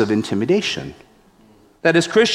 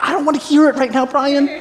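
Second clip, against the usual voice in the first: high, fast, dismissive snap; irritated brush-off; 11 semitones higher, faster than usual. Retold or imitated. A kid snapping at his friend to drop it. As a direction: cut him off, you don't want to hear it.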